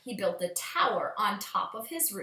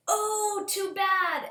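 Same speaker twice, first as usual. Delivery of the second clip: much higher, sing-song pity; high mock dismay from the neighbors; high neighbors' voice; 10 semitones higher, much slower than usual. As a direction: chirp it like a sorry neighbor. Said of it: "everybody said".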